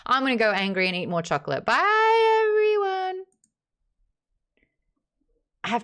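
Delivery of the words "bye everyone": high, loud farewell; called-out goodbye. Real, not acted. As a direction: sing out a big goodbye to the crowd. Calling goodbye to stream viewers.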